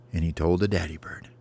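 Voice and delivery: usual speaking voice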